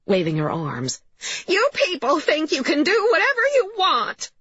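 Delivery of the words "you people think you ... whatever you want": high-pitched indignant character voice; outraged mother. A narrator's reading. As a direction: rail at the intruders, voice pitched way up. Upset mother rails at police.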